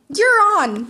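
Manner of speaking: high, loud yell back. A narrator's reading. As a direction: fire the challenge right back. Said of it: "Midoriya yelled back".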